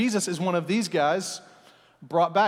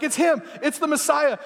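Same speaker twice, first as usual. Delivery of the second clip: thrilled disciples; 7 semitones higher than usual. Retold or imitated. Disciples realizing Jesus is the Messiah, thrilled and high. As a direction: gasp it out like you can't believe it. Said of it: "the disciples like".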